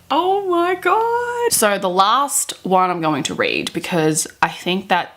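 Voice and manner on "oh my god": high, slow drawn-out disbelief. Real, not acted. Vlogger stunned by the fan fiction, slow high disbelief. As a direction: stretch it out, stunned and amused.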